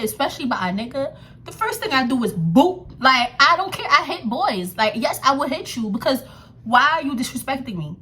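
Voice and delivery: ordinary talk, nothing marked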